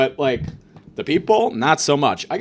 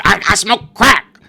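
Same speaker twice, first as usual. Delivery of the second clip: loud, slow, higher deranged voice; mock crazed patient; 4 semitones higher, 8 dB louder, slower than usual. Patient answering the nurse about drugs, loud crazed voice. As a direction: blurt it out like a crazed addict.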